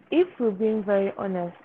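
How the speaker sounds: ordinary talk, nothing marked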